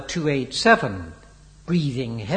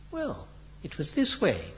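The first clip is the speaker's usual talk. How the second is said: soft, higher-pitched modest start; 7 semitones higher, 6 dB quieter than usual. A narrator's reading. Henry explains himself to Biggles, modestly. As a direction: begin softly, without boasting. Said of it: "replied Henry modestly".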